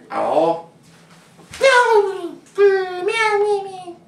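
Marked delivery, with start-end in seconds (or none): 1.6-4.0 high, loud meow; cat meow. Pretend. The cat answers the child with a high, loud meow. Pretend cat replies again.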